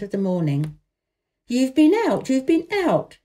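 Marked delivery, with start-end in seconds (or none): 1.5-3.2 put-on raised, eager boy's voice; excited cry. A narrator's reading. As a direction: burst out with delight. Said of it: "he cried".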